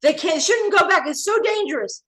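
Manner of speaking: high, loud frantic pleading; screaming at the TV. Retold or imitated. Shouting at the TV as people reenter, frantic high pleas. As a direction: beg the people on screen to stay out. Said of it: "we were screaming at our T V screens".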